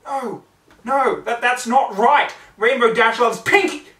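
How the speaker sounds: very loud, high protest; frantic denial. Acted. Twilight denying what she sees, loud and high. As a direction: refuse it at the top of the voice.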